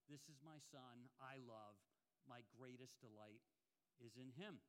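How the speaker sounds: quiet, awed reading of scripture; tender, reverent quote. Acted. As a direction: let God's words land softly. Quoting God's words at baptism.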